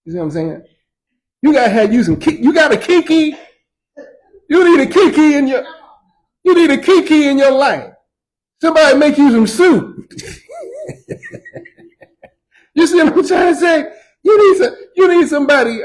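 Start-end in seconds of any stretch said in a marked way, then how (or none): 1.4-10.1 loud, high, rising preacher cry; preaching at full force. Acted. Pastor urges the church to find a Kiki, preaching loud. 10.2-11.6 high laugh. Real, not acted. Laughs at his own point. 12.7-13.9 laughing shout. Real, not acted. Laughing while preaching. 14.2-15.8 loud, high, rising preacher cry; preaching at full force. Acted. Pastor urges the church to find a Kiki, preaching loud.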